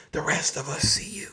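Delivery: hushed aside; quiet menacing whisper. Real, not acted. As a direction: lean in and whisper it.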